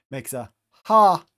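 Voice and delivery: ha with a strong breathed h; breathy h demo. Shown as a demonstration. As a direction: push air out on the ha. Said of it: "rough breathing mark gives that H sound".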